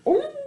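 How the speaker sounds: high wincing ooh; pained ooh. Real, not acted. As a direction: wince as if it stings. Reacting to the thumbtack picture.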